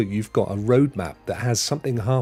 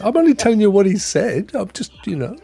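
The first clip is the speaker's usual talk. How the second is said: mock innocent; 8 semitones higher, 3 dB louder than usual. Teasing friend after pun.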